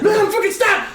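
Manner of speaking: very high, loud frantic shout; mock panicked yell. He flees his lunging mom, high panicked mock yell. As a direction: yell like someone under attack, hammed up.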